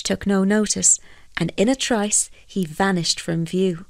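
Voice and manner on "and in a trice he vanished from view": plain reading voice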